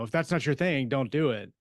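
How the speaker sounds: ordinary talk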